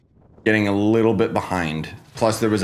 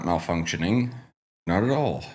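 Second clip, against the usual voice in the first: congested morning voice; blocked nose; 3 semitones lower, 5 dB quieter than usual. Real, not acted. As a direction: talk through a blocked nose. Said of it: "Ugh, morning congestion".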